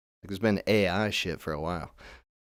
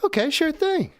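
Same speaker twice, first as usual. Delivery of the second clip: very high, squeaky helper voice; obliging bot, pitched way up; chirpy AI assistant. Acted. AI agreeing to turn it down, mock-chirpy. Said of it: "He's like".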